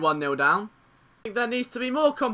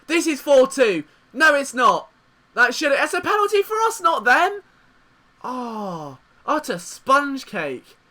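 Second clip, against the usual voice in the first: ordinary talk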